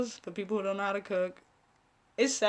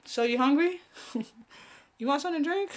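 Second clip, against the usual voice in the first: higher teasing sing-song offer; pestering host; 6 semitones higher, faster than usual. Retold or imitated. Host pestering her guest yet again, teasing higher voice. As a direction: ask again with mock innocence. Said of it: "And I'll be like".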